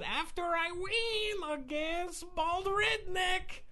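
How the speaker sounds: imitating her accented, confident announcement; hammed-up Polish accent; mock fighter accent. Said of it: "She actually said".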